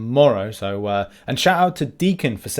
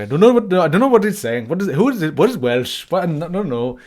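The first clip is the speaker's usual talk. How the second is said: light mock Indian accent, higher and louder; a quick, hammy bit of Indian accent; 4 semitones higher, 5 dB louder than usual. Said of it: "in New Delhi just going like".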